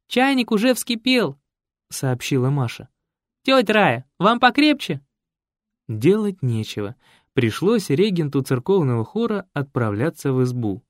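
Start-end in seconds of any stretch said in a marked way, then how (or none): 0.1-5.0 high, bright-voiced teenage girl; a lightly voiced young daughter. Masha offers aunt Raya tea, lightly voiced high.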